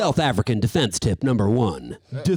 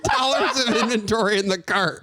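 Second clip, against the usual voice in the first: laughing disbelief; 13 semitones higher than usual. Real, not acted. Shocked at huge cart total.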